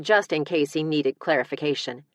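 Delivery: ordinary delivery, nothing marked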